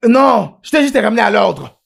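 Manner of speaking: loud, high-pitched defiance; angry retort. Acted. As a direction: fire back loudly at the teacher. Quarrel after the blow. (réplique furieuse, forte et aiguë)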